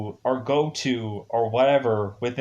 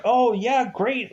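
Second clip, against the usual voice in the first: sarcastic; 10 semitones higher, slower than usual. Retold or imitated. Sarcastic teen quote.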